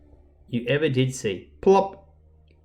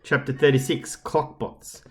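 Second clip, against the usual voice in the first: ordinary delivery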